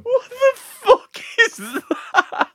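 high, slow laughing disbelief. Real, not acted. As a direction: squeal it through laughter. Laughing at the badger result.

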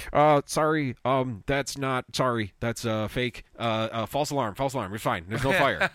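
hammed-up flustered hotel staffer; mock intercom apology, loud and fumbling; flustered intercom guy. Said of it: "a guy comes on the intercom, and he's like".

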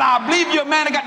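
husband praising the preacher; husband declaring. Retold or imitated. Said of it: "He said".

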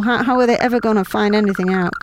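usual speaking voice

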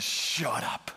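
breathy whisper of "Shut up". Retold or imitated. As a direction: hush it like a stunned lover. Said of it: "She says".

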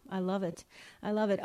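ordinary talk

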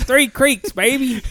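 high, proud school shout-out; hyped shout-out. Real, not acted. Bragging to a friend about his old school, high and proud. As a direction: call it out like a team cheer.

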